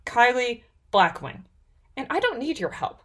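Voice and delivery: high, assured heroine voice; confident. A narrator's reading. Heroine rebuffing the half-orc, confident. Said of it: "Kyra said with confidence".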